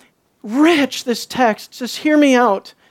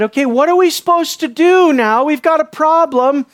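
raised, higher mock-helpless appeal; acted fretful demand for help; flustered asking; 5 semitones higher, 3 dB louder than usual. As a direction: ask the priest as if stuck. Acts worried people asking the priest.

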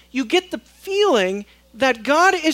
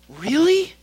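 incredulous; 6 semitones higher, 4 dB louder than usual. Real, not acted. Incredulous aside.

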